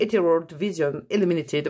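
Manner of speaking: English name stumbled over in French accent. Real, not acted. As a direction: fumble the English with French sounds. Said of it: "mon accent anglais". (anglais approximatif à l'accent français)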